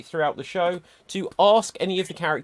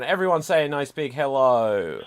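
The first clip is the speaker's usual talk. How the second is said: usual speaking voice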